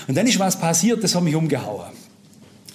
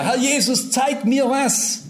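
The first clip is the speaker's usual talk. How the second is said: higher, slow desperate plea; preacher reliving his plea to Jesus; retold crying out; 7 semitones higher, slower than usual. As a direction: stretch "zeig mir was" upward, pleading. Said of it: "Hab ich weiter geschrien".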